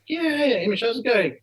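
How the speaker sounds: high-pitched imitation of players saying hi; retold hello, pitched well up; players' friendly greeting. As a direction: voice footballers casually greeting a fan. Players greet the starstruck fan.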